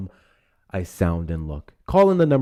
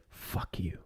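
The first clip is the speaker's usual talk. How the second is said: barely voiced, very soft; hushed mock insult; 4 semitones lower, 12 dB quieter than usual. Host quoting the voters' answer, in a whisper.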